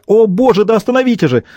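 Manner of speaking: high, alarmed plea; panicked cry. Acted. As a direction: cry out for it to stop. Pounding on the door in panic.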